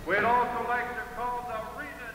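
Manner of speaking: slow, measured arena announcer; powwow emcee. Real, not acted. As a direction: call out names to the crowd.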